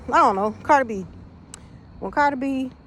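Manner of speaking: ordinary delivery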